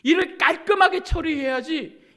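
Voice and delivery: imitated stern telling-off; demanding rebuke. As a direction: press a servant to do better, high and forceful. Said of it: "이렇게 말씀하신 거 아니에요".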